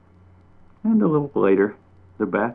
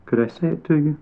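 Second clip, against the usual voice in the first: timid; 7 semitones higher, faster than usual. A narrator's reading. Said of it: "He sounded timid, guilty almost".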